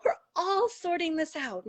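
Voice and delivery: real choked-up, high voice; tearing up. Real, not acted. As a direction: say it through tears, pitched high. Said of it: "I'm still tearing up".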